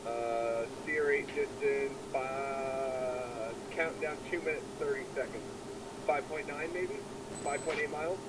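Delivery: muffled mask mic radio; through oxygen mask radio. Real, not acted. Wingman radio call, flight sim.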